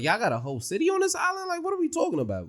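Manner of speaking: high, quick disbelief; incredulous. Retold or imitated. Viewer reacting to a whole city on the island, incredulous.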